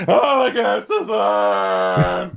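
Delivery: loud, high squeal of mock delight; hammed-up thrilled fan shriek; Luann. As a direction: gush it at full volume, drawn out. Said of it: "Luann's just loving it".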